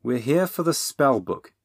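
no-nonsense correction; firm. A narrator's reading. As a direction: put it firmly. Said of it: "said Bernie firmly".

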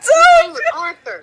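high shrieking laughter; laughing hard. Real, not acted. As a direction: howl with laughter. Both crack up.

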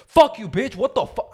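hammed-up outburst at the world; mock furious yell. Showing his friend the anger he never shows, mock shouting. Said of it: "it's just a joke".

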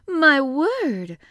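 loud, high exclamation of delight; delighted cry. A narrator's reading. Maid hears about elephants and tiger hunts, delighted cry. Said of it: "cried delighted Martha".

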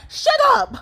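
high-pitched mock snapping; aggressive little brother. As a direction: imitate a rude kid brother's retort. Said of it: "like, really aggressively".

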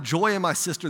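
ordinary delivery

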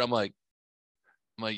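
usual speaking voice

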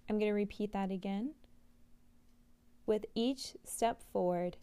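guided affirmation, unhurried. Acted. Reads the walking mantra.

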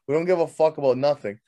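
usual speaking voice

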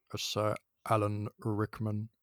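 slow, low, soft actor impression; Alan Rickman. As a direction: draw it out low in a poor imitation. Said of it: "That was also a really bad impression".

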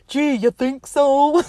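slow, dumb sarcastic drawl; imitating Steve Martin's dopey deadpan; dopey sarcasm. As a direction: play it dim and sarcastic. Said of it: "a dur-dur-dur type of thing".

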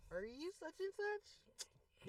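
high, very slow, drawn-out question; mocked clueless passenger. Passengers opening the door to ask, mocked cluelessness. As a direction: mimic a dim, hesitant rider.

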